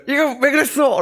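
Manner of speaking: a mother demanding an answer, played up high; voicing mom asking why he did it, high and stern; scolding mother. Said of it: "어머니가 예를 들어서".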